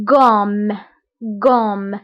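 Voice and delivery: غوم said as French rhum; teacher modelling غوم as French rhum; French word model. Shown as a demonstration. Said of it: "عندي".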